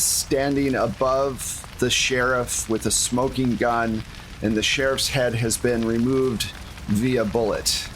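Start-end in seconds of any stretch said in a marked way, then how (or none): none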